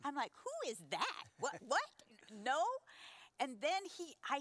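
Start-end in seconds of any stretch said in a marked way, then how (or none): 0.4-2.6 higher, louder comic disbelief; incredulous. Retold or imitated. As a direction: act out baffled disbelief, question after question. Acts out her disbelief at God's praise.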